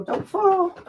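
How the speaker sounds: talking to her camera on the step; playful warning. Real, not acted.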